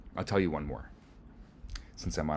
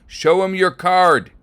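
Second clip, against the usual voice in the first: much higher, louder, slow call across a field; 18 dB louder, slower than usual. Acted. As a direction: holler it with cupped hands. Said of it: "cups his hands and calls out".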